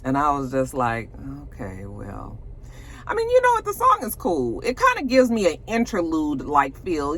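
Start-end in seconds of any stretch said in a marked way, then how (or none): 1.4-2.4 very low, soft reaction; underwhelmed. Retold or imitated. Host reacting to the song, quietly underwhelmed. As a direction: drop low and quiet, unimpressed.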